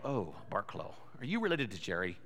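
low, soft mock townsperson asking; lightly voiced nosy stranger; curious stranger. A stranger quizzing the son, softly mocking.

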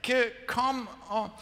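high-pitched herding call; mock impatient shepherd; exasperated shepherd. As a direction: urge stubborn sheep along. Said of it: "God sometimes feels like that shepherd".

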